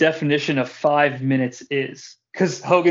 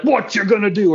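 higher, louder wrestling-promo bark; Hulk Hogan impression; 6 semitones higher, 4 dB louder than usual. Host showing his cohost an easy Hogan catchphrase, mock promo. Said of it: "if I give you a Hogan impression".

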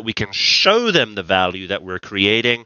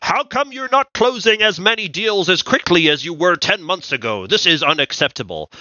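demanding board member; 7 semitones higher than usual. Retold or imitated. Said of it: "a board that says".

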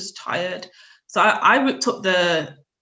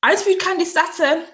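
surprised; 11 semitones higher, 7 dB louder, faster than usual. Real, not acted. Surprised the host knows this.

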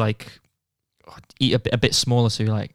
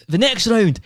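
higher, louder hammed-up game announcer; mock announcer; 7 semitones higher, 4 dB louder than usual. Friend announces the paintball round like a host.

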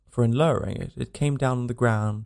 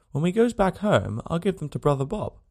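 put-on high, innocent child's voice; a puzzled small girl asking for mother; young girl, artless and thirsty; little girl; 7 semitones higher than usual. A narrator's reading.

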